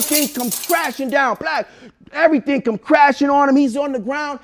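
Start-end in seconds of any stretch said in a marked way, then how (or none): none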